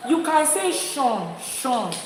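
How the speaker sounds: "Sean" said slowly and clearly; slow clear demo. Shown as a demonstration. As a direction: model the word "Sean" slowly, like a teacher. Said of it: "Just say Sean go".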